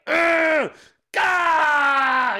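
high, hammed-up lifting grunt into a long cry; mock straining groans. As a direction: groan as if heaving a huge weight. Father straining to lift kid.

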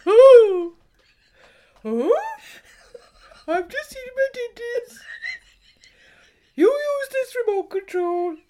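high, loud put-on voice of her co-host; squeaky teasing caricature of her friend; mock Shiv impression. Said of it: "Here's my Shiv".

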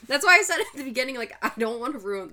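choking up while laughing. Real, not acted. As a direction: voice catching, half choking. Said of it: "sorry, I'm, like, choking".

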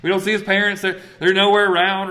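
lightly voiced priests asking whose boy this is; puzzled old teachers. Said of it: "they're like".

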